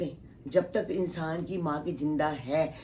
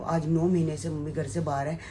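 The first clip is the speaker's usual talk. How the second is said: ordinary delivery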